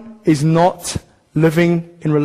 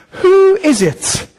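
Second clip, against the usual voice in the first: high, slow rhetorical question; a slow, lifted challenge to the room; drawn-out high question; 10 semitones higher, much slower than usual. Real, not acted. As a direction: stretch it out, pitched high, to make them think.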